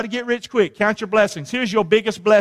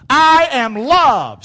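loud, high, drawn-out affirmation; emphatic declaration; 5 semitones higher, 6 dB louder, much slower than usual. Shown as a demonstration. Preacher leading the congregation to repeat it, loud slow declaration. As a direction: proclaim it word by word, very slowly.

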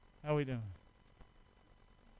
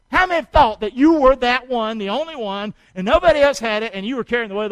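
preaching exhortation; 6 semitones higher, 13 dB louder than usual. Acted. Preacher urging his church to stay alert, in a loud exhortation. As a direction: punch each command out to the church.

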